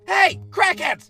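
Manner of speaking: shout. Acted. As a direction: yell across the street. Teacher calling out.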